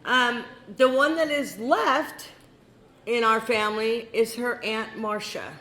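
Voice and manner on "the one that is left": ordinary delivery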